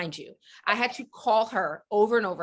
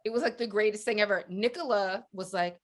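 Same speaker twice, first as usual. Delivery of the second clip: ordinary delivery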